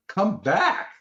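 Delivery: incredulous yell at the cashier; mock yell. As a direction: throw it back at them in disbelief, slowing on Tuesday. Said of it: "I'm yelling at 'em like".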